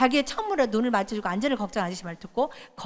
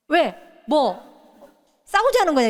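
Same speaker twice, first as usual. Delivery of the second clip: sharp, raised, picking a fight; mock hostile challenge; 4 semitones higher, 4 dB louder, slower than usual. A stranger glaring back, picking a fight. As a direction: bark it like someone looking for a fight. Mimics hostile Korean face.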